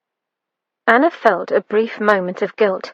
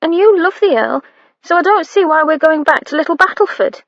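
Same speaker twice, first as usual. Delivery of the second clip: put-on young maid; 7 semitones higher, 3 dB louder than usual. A narrator's reading.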